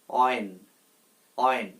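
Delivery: "অয়েন" as a short "wen", no h; silent h. Shown as a demonstration. Teacher giving learners the correct form of when, "অয়েন" with silent h. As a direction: open "অয়েন" straight on a w glide. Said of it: "এটার সঠিক উচ্চারণ হচ্ছে".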